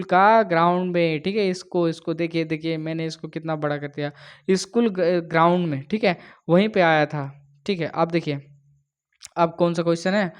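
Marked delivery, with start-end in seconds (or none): none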